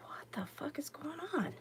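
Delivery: hushed whispered curse; startled whisper at a honking truck; whispered swear. Retold or imitated. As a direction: whisper it under your breath.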